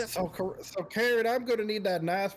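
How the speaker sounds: flirty put-on southern twang; southern Anatoly voice. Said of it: "likes this kind of accent".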